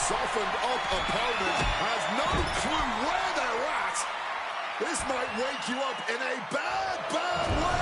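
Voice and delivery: lively live play-by-play call; wrestling commentator. Acted. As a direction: call the match like a ringside announcer.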